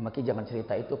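ordinary talk, nothing marked

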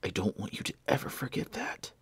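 soft urgent whisper; dying whisper. Acted. Dying Blair to Benjamin, a weak urgent whisper. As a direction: press him to go, barely voiced.

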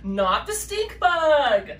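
slow, loud, high triumphant reveal. A narrator's reading. Narrator revealing the vote result, slow and triumphant. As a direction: stretch out "not the stink bug" in triumph.

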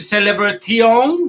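"celebration" as "se-le-bre-ti-ON", T kept; wrong Spanish-style pronunciation. Shown as a demonstration. Teacher tests learners with the mistake, mispronounced. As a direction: end "celebration" on a rising literal "ti-on".

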